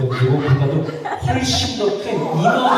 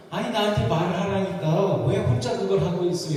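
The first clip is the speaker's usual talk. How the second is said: re-enacted nag in a higher voice; mock scolding, pitched up; nagging; 6 semitones higher than usual. Husband to wife who moved furniture alone, nagging. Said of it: "이러면서 막 또 잔소리를 했습니다".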